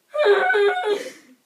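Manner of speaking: loud, high-pitched wail; mimicked howl from the painful moment; imitated pained cry. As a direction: let out a high, loud cry of pain, acted out. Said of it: "that's kind of the noise I was making".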